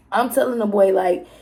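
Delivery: ordinary talk, nothing marked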